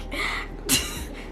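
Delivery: soft breathy laugh; quiet real laugh to shrug off the hurt; rueful laugh. Real, not acted. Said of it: "I have to laugh this shit off".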